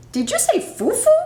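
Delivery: can't believe that name; incredulous. Acted.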